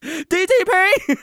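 high, drawn-out yell; screaming out. Pretend. Handcuffed man yelling his slogan at police, slow high yell. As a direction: chant it defiantly as you're hauled off. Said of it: "you start screaming out".

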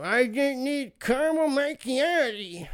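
slow, high, garbled dimwit voice; exaggerated mock idiot, words smeared and stretched; mouth-breathing idiot. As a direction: mangle the words like a hammed-up dolt. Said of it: "all the fucking mouth-breathing idiots who".

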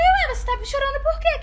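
high, loud retold taunt; mock confrontation. Girl retells taunting her crying rival, high challenging voice.

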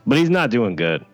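ordinary talk, nothing marked

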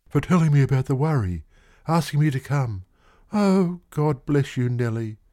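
lightly voiced eager clerk; fervent young man. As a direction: pour out thanks to her.

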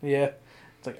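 normal talking voice

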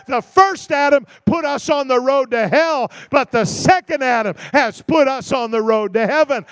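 loud, rising preacher's cadence; preacher calling out Adam against Jesus; full-force preaching. Acted. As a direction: hammer each contrast home to the church. Builds contrasts at full preaching force.

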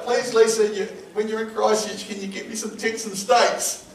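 high-pitched mock begging; pleading. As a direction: plead like a hopeful husband. Acted begging wife for steaks.

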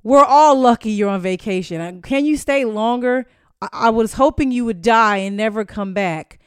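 loud, jeering read of hater tweets; loud mock of online trolls. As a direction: bellow the fans' insults back. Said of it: "fans replying saying".

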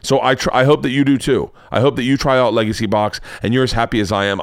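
ordinary talk

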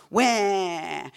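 loud, drawn-out wah; mock outburst, one long cry; imitated wail. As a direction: let out a sudden wail of feeling. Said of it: "you just go".

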